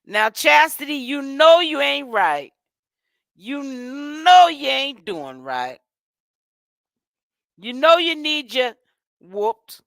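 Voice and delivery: slow, loud scolding of a wayward sister; a slow, loud, reproachful "you know you ain't right". Acted. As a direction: drag each word out like a stern auntie. Scolding Chastity directly.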